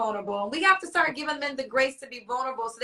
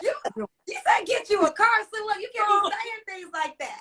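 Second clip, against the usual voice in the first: laughing through tears; 8 semitones higher than usual. Real, not acted. Host to guest who promised her a car, laughing through tears. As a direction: choke up while you laugh at his promise.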